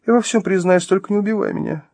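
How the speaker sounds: high, pleading voice; terrified surrender. Acted. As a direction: promise to confess, begging for mercy. Terrified woman begs after countdown.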